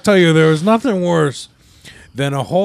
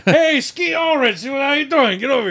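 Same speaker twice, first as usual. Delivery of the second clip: calling out; 8 semitones higher than usual. Real, not acted. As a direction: call out to someone across the room. Calling to a kid.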